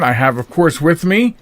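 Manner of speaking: normal talking voice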